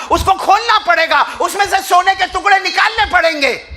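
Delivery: high, loud impassioned preaching; preaching at full force. Acted. Preacher telling the congregation the story, in loud impassioned preaching. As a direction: call it out to the crowd with fervour.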